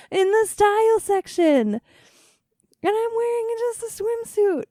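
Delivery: gleeful high-pitched announcement; excited squeal. Real, not acted. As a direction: shout the news up high like you can't believe it. Repeating the news, overjoyed.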